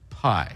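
"Pie" said alone as the first syllable, then a stop; a single clear syllable "Pie". Shown as a demonstration. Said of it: "syllable by syllable".

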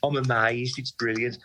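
gushing mock Brummie; mock Brummie accent. Mocking Jack's gushing in a Brummie accent. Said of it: "in his Brummy accent".

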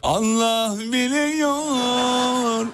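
drawn out. Pretend. Drawn-out echo of partner.

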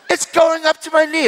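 very high, loud mock shriek; imitated alarmed cry. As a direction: yelp it as the startled woman. Retold woman alarmed by healing.

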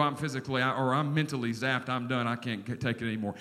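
exhausted person giving up, quiet and low; weary mock collapse. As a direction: sink into exhaustion as you go.

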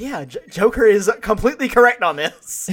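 loud, high, emphatic; emphatic agreement. Real, not acted. Telling the cohost he agrees with Joker, loud and emphatic. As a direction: shout your agreement.

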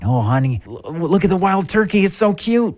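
high, put-on cooing tourist; hammed-up high-pitched gushing. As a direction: play a delighted tourist calling a partner over. Playing a tourist in a skit.

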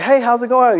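high, overly friendly church lady hello; sweet churchy greeting. Retold or imitated. Church lady greeting two girls in Compton, bright and sugary. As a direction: chirp it like a nice church lady.

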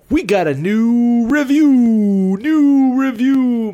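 big, slow, high announcer call; hype announcer voice. Acted. Host hyping the new listener review, big announcer call. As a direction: sell it like a game-show reveal.

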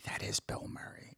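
breathless stage whisper of disbelief; whispered to a friend on the sidewalk. Retold or imitated. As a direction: hush it like you can't believe who's there. Awed recognition, whispered aside.

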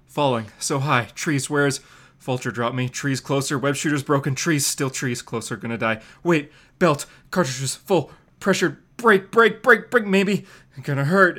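frantic inner monologue mid-fall; panicked falling hero. Acted. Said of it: "He goes".

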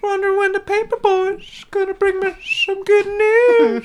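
sleazy cartoon creep; creepy pervert voice. Doing the Family Guy pervert.

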